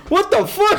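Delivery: high, loud mock shock; imitated startled wife. Wife jolted by husband's yell, high loud startled cry. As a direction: exclaim it in hammed-up alarm. Startled wife's reaction, acted out.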